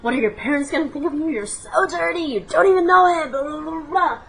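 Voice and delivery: high, loud frantic inner scolding; panicked self-scolding. Retold or imitated. Scolding herself in the elevator, loud and frantic. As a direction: berate yourself in a panic.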